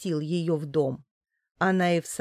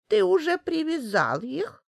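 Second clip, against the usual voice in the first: put-on old woman voice; 9 semitones higher than usual. A narrator's reading. Old woman asks Finlay.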